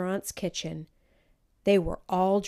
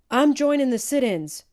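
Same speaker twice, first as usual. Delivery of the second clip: much higher and louder, eager; bursting in with news; excited young man declaring; excited announcement; 7 semitones higher, 11 dB louder than usual. A narrator's reading. Brother voiced bursting in.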